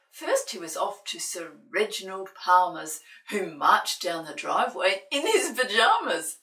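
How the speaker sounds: loud, lively picture-book reading; story narration. A narrator's reading. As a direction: read it big for little ones.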